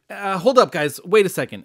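Abraham calling the departing angels back, loud and urgent. Acted. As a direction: hold up, call them back before they leave.

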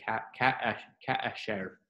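Ka, ka, ka, share, halting, each ka split off; tongue-tied ka share. Shown as a demonstration. Teacher showing a tongue-tied compound shva, halting. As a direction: stumble through Ka, ka, ka before share. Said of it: "sounds like you're tongue-tied".

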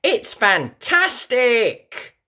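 put-on high, slow, delighted little girl; Peppa voice. A narrator's reading. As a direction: stretch it out with joy. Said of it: "says Peppa".

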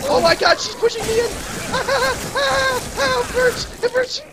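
high, loud panicked cries; panicked screaming. Real, not acted. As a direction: scream in panic under attack. Witch attacking her.

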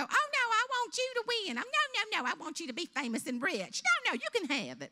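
high, loud pageant protest; hammed-up self-sacrificing beauty queen; gushing fake modesty. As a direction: gush insincerely over your rival. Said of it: "she's supposed to look back and go".

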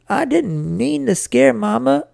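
put-on high, hurt boy's plea; sniffling. A narrator's reading. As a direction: plead your innocence through sniffles. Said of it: "I sniffled".

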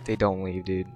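ordinary delivery

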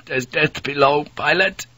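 put-on a weary young Dutch sailor pleading; Dutch mate.

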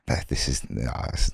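breathy inner worry, almost silent; whispered thought. Retold or imitated. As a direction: think it to yourself under your breath.

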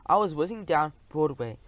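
ordinary delivery

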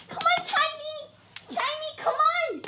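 excited high voice greeting the puppy; high pet talk. Real, not acted. Calling the dog.

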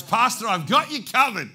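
high, very loud booming promise; exuberant shout. Retold or imitated. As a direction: shout it out with full confidence. Dying man's emphatic promise, retold.

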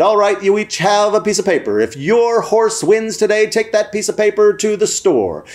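high, sing-out announcer voice; microphone announcer. Retold or imitated. Announcer explaining the chocolate prize to children, high-pitched announcing. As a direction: explain it like a race-track announcer. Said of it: "the man with the microphone explained".

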